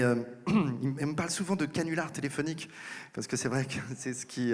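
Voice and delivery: usual speaking voice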